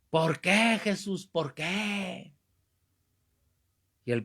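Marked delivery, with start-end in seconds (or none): 0.1-2.3 high, long-drawn plaintive wail; mock anguished outcry. Man interrupting the priest's preaching, mock anguished outcry. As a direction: imitate a man wailing in the middle of church. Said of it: "de repente se oía que decían".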